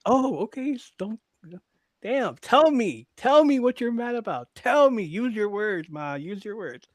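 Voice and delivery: whiny teasing plea; mock pleading son. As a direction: beg mom to talk, hammed up. Pleading with mom, mocking.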